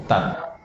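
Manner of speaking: "Tab" stopped dead on closed lips; cut-off b. Shown as a demonstration. Teacher shows students the cut, cut-off b. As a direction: end "Tab" with no sound escaping.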